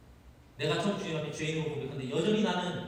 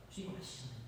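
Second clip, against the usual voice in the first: much softer, timid Peter; unsure hush; 5 semitones lower, 16 dB quieter than usual. Acted. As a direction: say it under your breath. Peter lacks confidence.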